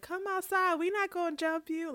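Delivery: high-pitched mock taunt from a cartoon girl; Penny Proud. Podcaster quoting a meme of friends calling someone outside, high mock coaxing. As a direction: sing out a meme quote, pitched well up. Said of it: "Penny Proud and her friends".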